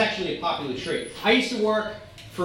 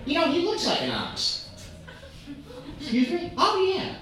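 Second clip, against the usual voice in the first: Queens mom; 9 semitones higher than usual. Retold or imitated. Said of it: "this lady from Queens".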